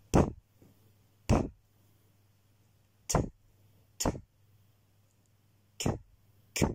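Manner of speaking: isolated "p", "t", "q" as bare puffs of air; plosives isolées. Shown as a demonstration. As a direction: pop each "p", "t", "q" with no vowel. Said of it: "vous entraîner avec les sons P, T et Q". (« p », « t », « q » soufflés, sans voyelle)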